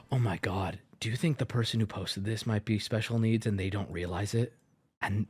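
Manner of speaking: low, soft dawning realization with gasps; hushed dismay. Pretend. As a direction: murmur it in mock horror. Mock dawning horror about the poster.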